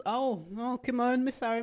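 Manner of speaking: ordinary talk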